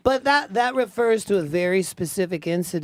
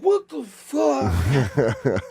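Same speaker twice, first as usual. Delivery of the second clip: very slow, low retold reaction; dazed mock disbelief; 5 semitones lower, much slower than usual. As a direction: stretch it out as if reeling. Retells reeling from freezing milk.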